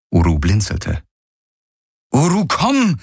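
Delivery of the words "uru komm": high, exasperated call; uncontrolled command. A narrator's reading. Kirai repeats the order to her mount, losing control. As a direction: lose patience calling the animal. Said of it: "wiederholte sie unbeherrscht".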